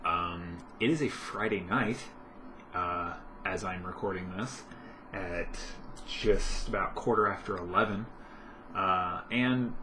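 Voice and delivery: normal talking voice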